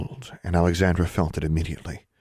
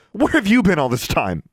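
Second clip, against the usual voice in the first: loud, high-pitched demand; angry confrontation; 12 semitones higher, 5 dB louder than usual. A narrator's reading. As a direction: demand an answer from someone long absent. Mother confronts Livia.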